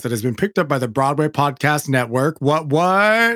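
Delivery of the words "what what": surprised. Real, not acted. News of a podcast pickup.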